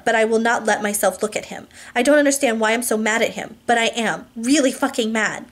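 plain reading voice

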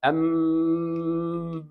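a long steady um hummed through the nose; nasal hum. Shown as a demonstration. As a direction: hold a level nasal hum. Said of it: "like this". (غنة ممدودة من الأنف)

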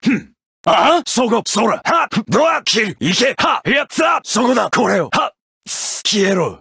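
short fighting yells; attack shouts. Acted. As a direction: spit each strike out.

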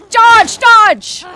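high, very loud panicked command; urgent shout. Real, not acted. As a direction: yell it like a life depends on it. Panicked fight in game.

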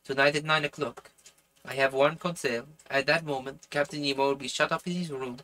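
put-on French-accented character voice; earnest plotter with a French accent. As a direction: read the dialogue in a French accent. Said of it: "I'm doing this French accent".

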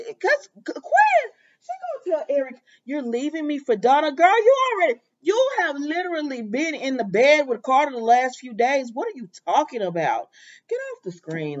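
fed up, telling her off; exasperated rant. Real, not acted. Viewer ranting at Quinn on the screen, exasperated.

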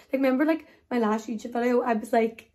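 normal talking voice

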